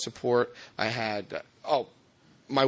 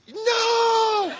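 laughing no; 3 dB louder than usual. Retold or imitated. Refusing sponsor, laughing.

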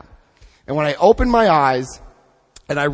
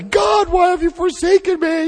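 melodramatic cry to God; 14 semitones higher than usual. Retold or imitated. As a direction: wail it at the ceiling like a forsaken martyr. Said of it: "something melodramatic like that".

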